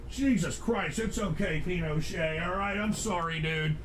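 very soft, hushed comforting; calm them down in a quiet voice; soothing reassurance. Real, not acted. Streamer reassuring upset Pinochet, soft and soothing.

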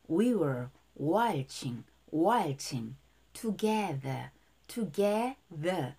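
"waltzing together" stretched very slowly, each sound clear; slow repeat-after-me drill; careful pronunciation drill. Shown as a demonstration. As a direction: drag out "together" so the th sound is heard. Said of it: "발음 자세히 따라해볼게요".

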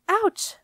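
sharp high ouch of pain; pained gasp. A narrator's reading. Jenna kicked under the table, yelping ouch. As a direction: yelp as if kicked in the shin. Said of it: "gasped Jenna".

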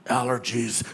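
a guy covering his tears with a mumbled excuse; mock excuse. Guy at a sad movie covering tears, low mumbled excuse. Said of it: "He's like".

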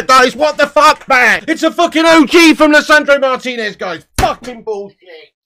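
usual speaking voice

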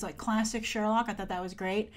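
ordinary talk

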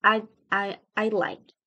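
"I" with a nearly silent d before "like"; "I like" with only a hint of d; barely heard D. Shown as a demonstration. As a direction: swallow the d of I'd before "like". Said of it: "esa D casi no suena".

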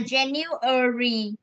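January slowly with the u sound clear; slow model pronunciation. Shown as a demonstration. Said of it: "cái này là ơ nhá".

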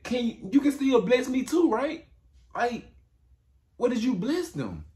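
high, pleading wail; Esau pleading. Retold or imitated. Esau pleading with his father, in a high desperate voice. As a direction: beg with a high voice. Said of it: "He said".

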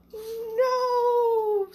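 high, loud squeaky creature no; a tiny critter squeals no; squeaky animal. Acted. Critter in the clock squeals no at the kids.

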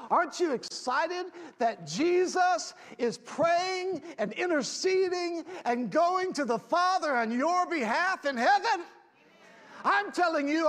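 rising, shouted preaching build; fiery preaching. Acted. Preaching builds to full force.

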